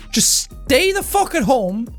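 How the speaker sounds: normal talking voice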